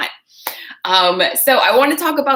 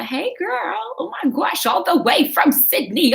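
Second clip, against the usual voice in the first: squealed hello, much higher; light up and squeal hello to a far-off viewer; thrilled high-pitched hi; excited greeting; 10 semitones higher than usual. Real, not acted.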